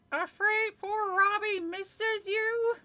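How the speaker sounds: high, slow, through gritted teeth; ventriloquist's high dummy voice; squeaky dummy voice. Acted. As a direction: speak for the puppet without moving your lips. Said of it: "Lindy made Slappy ask".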